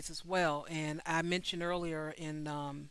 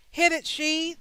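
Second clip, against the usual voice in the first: high, loud show-host cue; calling a cue; 10 semitones higher, 7 dB louder than usual. Real, not acted. Host cueing [name] to sing, calling out. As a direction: call out to kick off the song. Host cues the singer to start.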